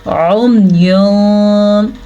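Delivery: slow उम्युन starting on a throat ain; ain recitation demo. Shown as a demonstration. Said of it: "आइन को किस तरह पढ़ा".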